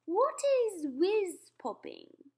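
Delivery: high, loud, very slow question; drawn-out royal puzzlement; puzzled Queen. Acted.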